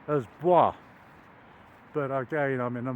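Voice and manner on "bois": "Bois" said the French way, like "bwah", a bit louder; French pronunciation. Shown as a demonstration. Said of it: "I want to pronounce B O I S as Bois".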